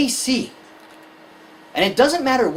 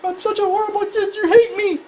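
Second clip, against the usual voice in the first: put-on high, wailing kid voice; distraught scolded child; 12 semitones higher than usual. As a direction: overdramatic child feeling hated. Scolded child's overreaction, acted.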